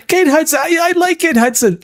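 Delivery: giddy fan gushing over Kate Hudson; mock gushing. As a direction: squeal it like a starstruck fan.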